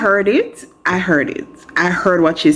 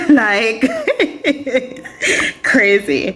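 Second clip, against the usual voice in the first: laughing; 6 semitones higher, much slower than usual. Real, not acted.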